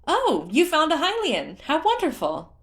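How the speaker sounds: high, loud delighted cry; excited cry. Acted. Woman in the water cries out, high and thrilled. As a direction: gush with delight at the find. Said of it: "a woman cried out".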